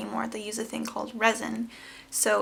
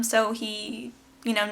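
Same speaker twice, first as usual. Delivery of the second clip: ordinary talk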